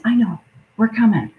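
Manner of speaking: usual speaking voice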